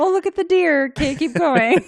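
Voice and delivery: high, loud, hammed-up exclamation; mock oblivious delight. Acting out oblivious driver seeing Sasquatch.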